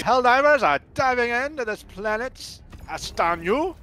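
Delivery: high newsreel announcer; newscaster voice. Acted. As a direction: keep the wartime broadcast going. Continues newsreel voice.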